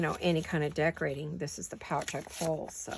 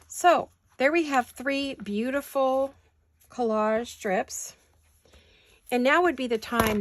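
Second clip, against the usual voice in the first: slow showcase; 6 semitones higher, 7 dB louder, slower than usual. Real, not acted. Presenting finished strips.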